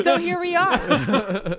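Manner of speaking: slow, high, laughing drawl. Real, not acted. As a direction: laugh it out slowly.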